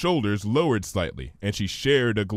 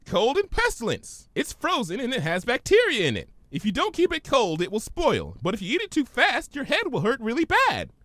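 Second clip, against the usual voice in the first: much higher, bubbly pony; Pinky's voice; 13 semitones higher than usual. Acted. Pinky puzzled by Twilight's big word, high voice.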